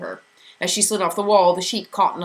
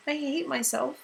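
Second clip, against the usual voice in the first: shakily; 9 semitones higher, 5 dB quieter than usual. A narrator's reading. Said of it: "Meg said shakily".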